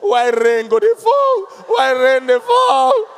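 slow, high, loud hammed-up wail; mock wailing. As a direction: wail like a grown man sobbing over football. Mock crying man lamenting.

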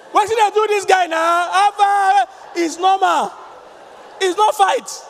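loud, high, slowed protest; loud family talk. Retold or imitated. Family member telling spouse, loud insistence. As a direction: insist it's not a fight, booming. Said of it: "some families that when they talk, they shout".

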